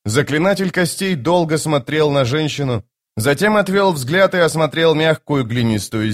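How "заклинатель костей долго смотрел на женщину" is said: plain reading voice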